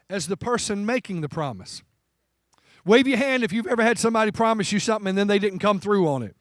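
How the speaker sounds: ordinary talk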